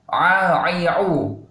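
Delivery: a, ai, au pressed from the throat; correct ain. Shown as a demonstration. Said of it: "Ingat ini ain ya". ("A, ai, au" dengan ain dari tenggorokan)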